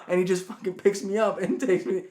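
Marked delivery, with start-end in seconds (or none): none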